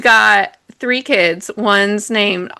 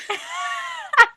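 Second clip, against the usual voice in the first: high shrieking laugh; 6 dB quieter than usual. Real, not acted. Laughs at snake mix-up.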